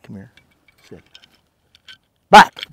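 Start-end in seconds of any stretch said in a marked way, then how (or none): 2.3-2.7 loud, higher sending command; sharp command. Real, not acted. Trainer sending dog back, loud sharp command. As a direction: bark the cue out loud.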